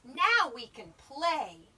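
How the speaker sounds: loud, slow, lightly voiced little bear; eager Little Bear. A narrator's reading. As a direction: announce playtime as a little bear.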